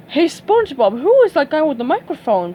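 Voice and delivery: puzzled curious question; Patrick. Acted. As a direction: Patrick asking about the host.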